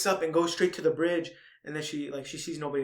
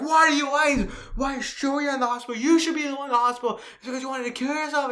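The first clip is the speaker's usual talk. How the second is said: very high, loud mock tirade; retold yelling; 11 semitones higher, 7 dB louder than usual. Film fan acting out Naoka's hospital accusation, yelling. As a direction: imitate a furious accusing yell. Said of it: "start yelling at Shoko".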